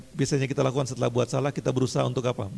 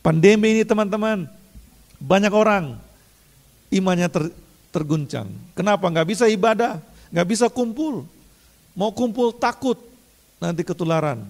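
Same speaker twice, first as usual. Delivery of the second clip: high, louder urgent sermon push; preaching at full force; 6 semitones higher, 5 dB louder than usual. Acted. Pastor urging the congregation not to waste grace, full preaching. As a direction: drive it home to the congregation.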